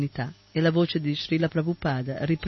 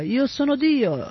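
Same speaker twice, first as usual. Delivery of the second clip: high, slow, drawn-out proclamation; shouting; 9 semitones higher, slower than usual. A narrator's reading. Boy twirls before Prabhupada, slow high shout.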